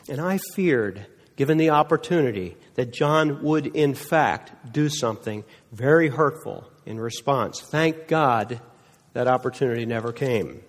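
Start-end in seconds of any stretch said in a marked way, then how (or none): none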